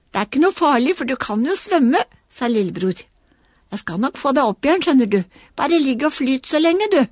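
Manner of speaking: lightly voiced child comforting; small boy voice. Little boy comforting the toy fallen in water, quick. Lillebror reassuring Knerten.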